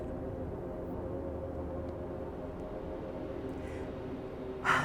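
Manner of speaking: long, soft, breathy emotional sigh; a real sigh as tears come close; long heavy sigh. Real, not acted. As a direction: let out a long shaky breath of sorrow. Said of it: "Sorry, I get emotional".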